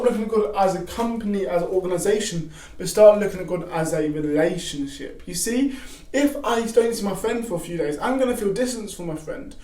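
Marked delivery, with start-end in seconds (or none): none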